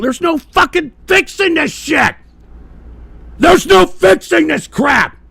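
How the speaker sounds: high, loud, furious yelling; furious shout. Real, not acted. Ranting streamer shouts at lazy young men in fury. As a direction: blow up at them, full volume.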